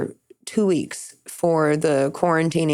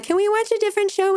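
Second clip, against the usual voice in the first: high, fast whiny plea; 14 semitones higher, faster than usual. Retold or imitated. Asking boyfriend to change the show, high and whiny. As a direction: beg to switch the show in a high whine. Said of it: "I was like".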